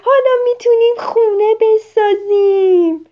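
high, loud delighted shout; thrilled child yelling; happy shout. Acted. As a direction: cry out with joy. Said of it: "با خوشحالی داد زد".

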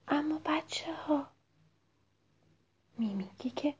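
put-on soft whisper to the kids; gentle storyteller whisper; hushed narration. A narrator's reading. Narrator hushes for sad turn.

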